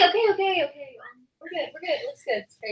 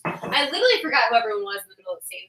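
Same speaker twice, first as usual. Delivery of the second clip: normal talking voice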